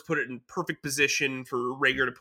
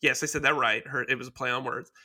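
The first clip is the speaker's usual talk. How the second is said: usual speaking voice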